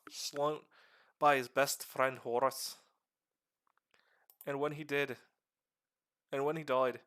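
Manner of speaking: rough put-on Irish accent held through a lore talk; streamer's half-Irish accent. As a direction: explain the lore in a loose, slipping Irish accent. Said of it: "I'm not even sure what kind of accent I'm doing right now".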